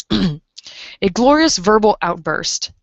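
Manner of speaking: slow, drawn-out and savoring each word; glorious verbal outburst spoken slowly; slow emphatic. Shown as a demonstration. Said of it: "repeats phrase slowly".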